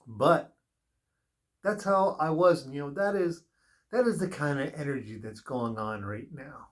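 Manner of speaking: ordinary talk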